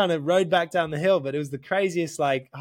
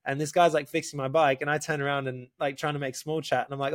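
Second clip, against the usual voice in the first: ordinary talk, nothing marked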